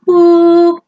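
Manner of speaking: loud, high held "whoop"; steady loud test tone by voice; imitated ringing tone. Shown as a demonstration. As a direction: mimic a strong tinnitus pitch on one note. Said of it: "your conscious brain hears".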